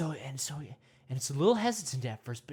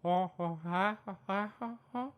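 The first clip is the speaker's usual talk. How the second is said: ha ha monkey hoots; talking to monkey; 4 semitones higher than usual. Pretend. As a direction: talk gently to the monkey. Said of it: "You go".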